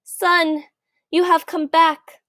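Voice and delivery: a father greeting his lost son; Lester, emotional father. A narrator's reading. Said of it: "said Lester".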